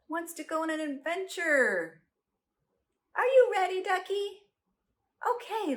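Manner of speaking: plain reading voice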